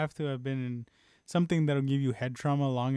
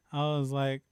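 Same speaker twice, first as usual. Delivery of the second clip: usual speaking voice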